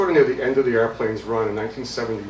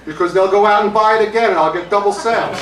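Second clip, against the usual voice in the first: loud, high hammed-up answer; acted eccentric, excitedly explaining his scheme; emphatic explanation; 10 semitones higher, 9 dB louder than usual. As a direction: deliver the punchline at full voice. Musician delivers absurd triumphant answer.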